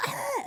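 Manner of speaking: high startled yelp; retold scream. Eating popcorn in the cinema, sudden high yelp. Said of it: "and I'm like".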